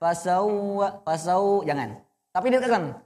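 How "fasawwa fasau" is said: ordinary delivery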